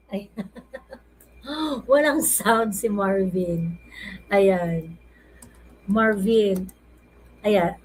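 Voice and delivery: slow, laughing through the words. Real, not acted. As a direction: giggle while saying it. Guest's mic is silent.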